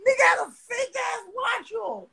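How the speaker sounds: high, squealing laughter through the words; laughing. Real, not acted. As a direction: barely get it out for laughing. Cracking up over the fake watch.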